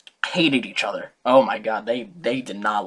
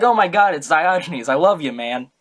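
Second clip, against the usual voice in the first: fangirling; 4 dB louder than usual. Retold or imitated. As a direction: squeal like a starstruck fan. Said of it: "he was fangirling".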